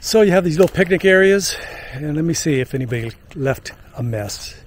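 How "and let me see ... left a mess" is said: ordinary talk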